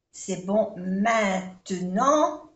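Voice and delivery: "C'est bon maintenant" spoken slowly, syllable by syllable; slow pronunciation model. Shown as a demonstration. Teacher modelling slow French for learners. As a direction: carefully spell out "maintenant" with nasal endings. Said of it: "vamos a hacer un poquito de pronunciación".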